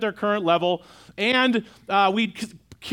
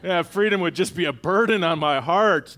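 sarcastic imitation of a contented slave; mock the idea with a put-on sarcastic voice; 3 semitones lower than usual. Pastor mocking a slave who would refuse freedom, sarcastic. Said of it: "the slave's not going".

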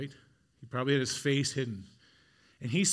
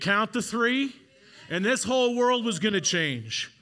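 louder, higher, driving sermon build; preaching at full force; 5 semitones higher, 3 dB louder than usual. Acted. Preacher listing what Mary lost, building loudly. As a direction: hammer each loss home to the congregation.

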